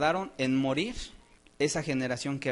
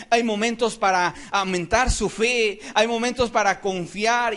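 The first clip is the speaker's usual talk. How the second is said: loud, raised, ringing sermon delivery; impassioned preaching; 5 semitones higher, 5 dB louder than usual. Real, not acted. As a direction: preach it with mounting force. Preaches at full force.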